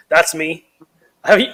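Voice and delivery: usual speaking voice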